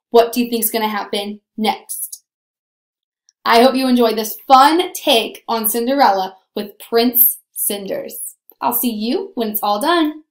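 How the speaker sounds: ordinary delivery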